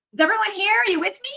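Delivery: high, bright call to the audience. Real, not acted. Calls out to silent viewers.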